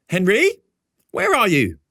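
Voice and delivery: the mother, high and sharp, demanding answers; a mother calling her son to account, annoyed; annoyed mother demanding to know where he is. A narrator's reading. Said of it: "My mother demands to know".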